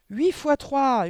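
high, loud howl of the answer; furious yell. A narrator's reading. Headmistress shaking the pupil, furious yell. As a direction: yell each word at full pitch. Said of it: "hurla mademoiselle Le Gourdin".

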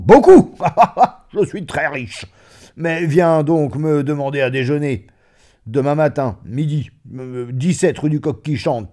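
high, loud, expansive joviality; self-satisfied big talker; boisterous, boastful friend. Acted. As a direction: burst out proudly, laughing, then invite heartily. Friend boasts and invites him.

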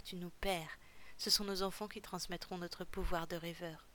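usual speaking voice

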